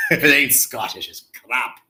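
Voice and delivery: put-on a mild, lightly done Scottish accent; playful bad Scottish accent. Greeting a Scottish viewer in a bad Scottish accent. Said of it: "such a bad accent".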